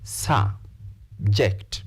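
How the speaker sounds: subject split into two separate beats, sub, ject; syllable by syllable. Shown as a demonstration. Teacher counting jaw drops, subject said in two separate syllables. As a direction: break subject in two with a clear gap. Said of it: "How many syllables?".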